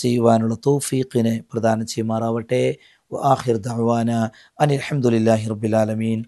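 teacher closes the class with a low blessing; closing prayer. Real, not acted.

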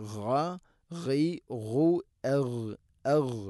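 throaty ghain sounds from the upper throat; ghain drills. Shown as a demonstration. Teacher drilling the ghain letter, throaty. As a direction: rasp out ഘ ഘൈ ഘു എഗ് from the throat. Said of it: "തൊണ്ടയുടെ മുകൾ ഭാഗമാണ് മഖ്റജ്".

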